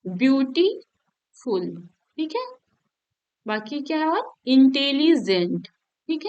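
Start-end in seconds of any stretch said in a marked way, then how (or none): 0.0-1.7 beautiful drawn out slowly, split as beauty-full; slow syllable demo. Shown as a demonstration. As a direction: stretch beautiful slowly to show two syllables. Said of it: "टू soluble का example है".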